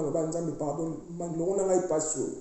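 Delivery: ordinary talk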